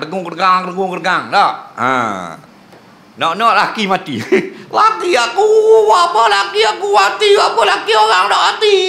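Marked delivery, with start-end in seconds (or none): 4.7-8.8 shrill high hammed-up wail, shouting through laughter; mock wailing widow. Preacher mocks a widow wailing at her husband's death, high shouted wail. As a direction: play a grieving wife howling over her husband, half laughing.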